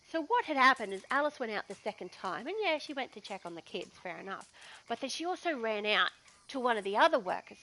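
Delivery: normal talking voice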